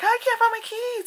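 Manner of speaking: very high imitated girl's voice; high-pitched pretend fretting; mock panic. Acting out girl searching for keys.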